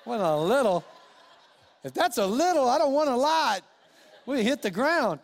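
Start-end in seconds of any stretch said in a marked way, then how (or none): 0.0-3.6 incredulous retort. Retold or imitated. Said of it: "I'm like".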